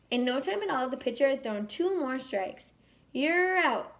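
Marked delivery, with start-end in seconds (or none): 3.1-4.0 mock umpire scream. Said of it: "the umpire screamed".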